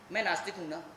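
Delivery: normal talking voice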